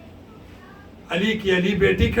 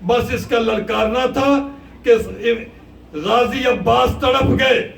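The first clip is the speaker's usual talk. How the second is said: preaching at full force; 4 semitones higher, 4 dB louder than usual. Acted. Preacher narrates Abbas's anguish, loud and driving. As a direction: drive the battle story home to the crowd.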